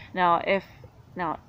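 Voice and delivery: normal talking voice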